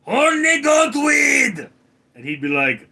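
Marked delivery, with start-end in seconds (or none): 0.0-1.7 high, loud shouted command; full-throated scold at a misbehaving dog; screaming at the dog. Retold or imitated. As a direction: yell it at the dog to scare him. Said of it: "I'd scream".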